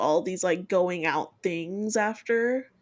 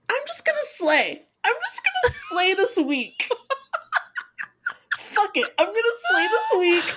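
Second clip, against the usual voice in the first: much higher, laughing hype talk; pumped-up hype; 13 semitones higher than usual. Retold or imitated. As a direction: hype yourself up, giggling. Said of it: "And I was like".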